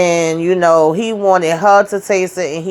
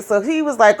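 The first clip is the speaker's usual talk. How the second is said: ordinary talk, nothing marked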